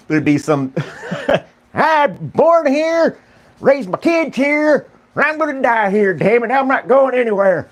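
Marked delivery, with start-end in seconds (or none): 1.7-7.7 high, slow mock hillbilly holdout; stubborn old local. Mocking a local who refuses to evacuate, high stubborn drawl. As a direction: ham up a stubborn old man refusing to leave.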